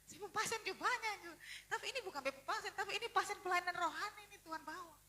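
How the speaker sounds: loud, high, insistent argument; animated protest. Retold or imitated. Doctor reasoning over the patients God brings, loud and agitated. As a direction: argue it out at full voice. Retold protest about own workload.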